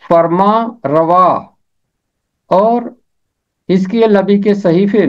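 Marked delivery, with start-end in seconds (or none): 0.0-1.3 फरमा रवा slow and deliberate; teacher demonstrates फरमा रवा; Urdu pronunciation. Shown as a demonstration. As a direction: model फरमा रवा carefully for the class. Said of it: "Urdu में हम इसे नून गुन्ना के साथ पढ़ेंगे".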